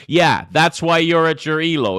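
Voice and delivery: loud, high, sarcastic comeback; loud mock agreement, dripping with sarcasm; scornful retort. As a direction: fire back mockingly at the complainers. Streamer mocks low-rated chatters back.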